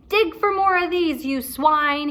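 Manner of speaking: high, loud pirate snarl; captain ordering pirates to dig; captain's order. Acted. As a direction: spit it at the crew. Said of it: "he said".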